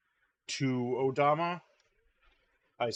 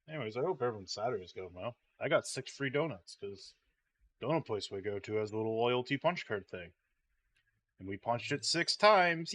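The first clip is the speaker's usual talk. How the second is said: ordinary talk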